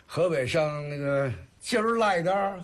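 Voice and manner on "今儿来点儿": lilting Tangshan dialect, pitched up; Tangshan accent. Shown as a demonstration. Artist demonstrates Hebei speech for the interviewer, Tangshan accent. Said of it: "唐山的".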